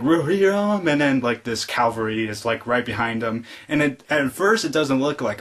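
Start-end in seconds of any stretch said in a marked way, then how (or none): 0.0-0.9 high, loud mock battle call; imitated rallying cry. Nephew summoning the cavalry behind him, mock rallying cry. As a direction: call the riders like a war leader.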